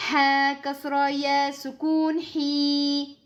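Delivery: slow chanted letter names; spelled recitation. Shown as a demonstration. Teacher spelling the letters for the student. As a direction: draw out each syllable. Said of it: "Deux temps pour le ya".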